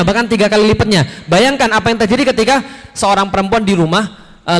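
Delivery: usual speaking voice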